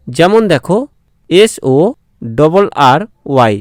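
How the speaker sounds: ordinary talk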